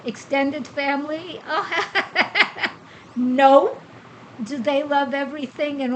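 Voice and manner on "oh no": laughing no. Real, not acted. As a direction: burst out laughing, then a loud "no". Asked if she likes everyone.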